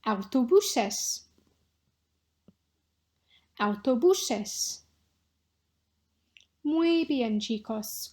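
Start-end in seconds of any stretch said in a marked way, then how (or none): 0.1-1.2 autobuses said slowly, every syllable clear; slow model pronunciation. Shown as a demonstration. Teacher models autobuses slowly for repeating. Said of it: "pronunciation practice". 3.6-4.8 autobuses said slowly, every syllable clear; slow model pronunciation. Shown as a demonstration. Teacher models autobuses slowly for repeating. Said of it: "pronunciation practice".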